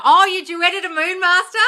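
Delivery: high, loud exclaimed question; exclaimed surprise. Acted. Streamer hears of a viewer's duet, exclaimed surprise. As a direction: react big, pitched right up. Playful reaction to viewer's news.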